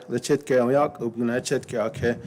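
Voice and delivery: normal talking voice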